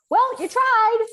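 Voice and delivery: put-on young child shrugging off mom's failure; child's voice. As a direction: chirp it like a blunt seven-year-old. Seven-year-old consoling mom.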